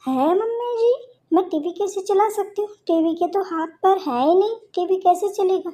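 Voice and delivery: put-on high, innocent young bride voice; naive daughter-in-law. (ऊँची, भोली बहू की आवाज़)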